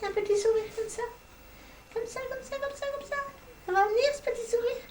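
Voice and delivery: high, soft coaxing coo. Real, not acted. Grandmother coaxing the baby to smile, high soft coo.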